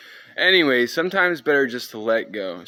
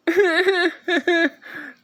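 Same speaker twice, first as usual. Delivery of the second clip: high-pitched rattling laugh; high cackling laugh; 4 dB louder than usual. Real, not acted. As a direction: let out a long, high laugh. Laughs after the breathing intro.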